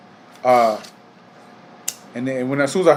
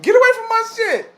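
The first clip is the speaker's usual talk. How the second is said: mock yell; 4 dB louder than usual. Worker chasing off the girl.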